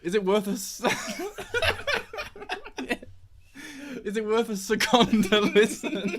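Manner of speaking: corpsing on the scripted line; can't stop laughing. Real, not acted. Host attempting his watch pun for the intro, laughing. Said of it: "I can't say it without laughing".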